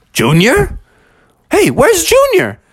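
rising, high-pitched searching call; puzzled, then high and urgent; alarmed father. Acted. Father discovers his child is missing.

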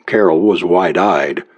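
usual speaking voice

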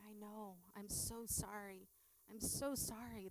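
hushed, gentle sympathy for a grieving mother; soft consolation. Retold or imitated. Comforting her grieving friend Donna, soft and hushed. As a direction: softly console her, half under the breath.

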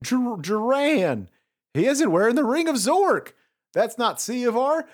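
alarmed cry. Acted. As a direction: cry out the warning, high. Bivitar warns of the imposter.

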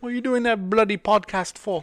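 high mock British grumble; mock British accent. Host ribbing co-host in a jokey British accent. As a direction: play a cheeky Brit scolding. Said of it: "do a stupid accent like that".

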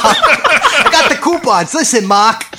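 high, loud, drawn-out Boston impression; hammed-up Boston guy, half laughing; Boston accent. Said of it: "he's like".

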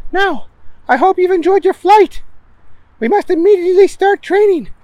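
high, loud brisk lecture; high bossy professor. Acted. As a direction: lay out the plan briskly. Stinkwell voiced.

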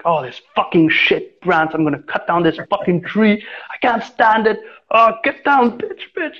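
muttered cursing that climbs into high frustrated yelps; reliving the fed-up climber cursing the tree, rising as it goes; starts grumbling, ends in a high mock yell at the branch; retold swearing at a branch. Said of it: "You know, like, I start swearing".

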